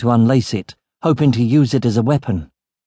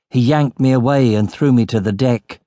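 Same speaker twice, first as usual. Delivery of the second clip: plain reading voice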